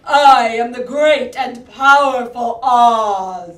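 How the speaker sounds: slow, loud, commanding wizard; booming Oz. Shown as a demonstration. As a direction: boom it out like the great Oz. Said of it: "push that heat in, stand strong".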